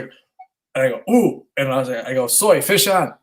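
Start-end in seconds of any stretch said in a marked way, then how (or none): 1.1-1.5 higher-pitched retold "ooh"; quick high "ooh" of a sudden bite; surprised ooh. Retelling his surprise at a bite. 2.3-3.1 retold fishing call, pitched up; excited call. Calling son about the bite.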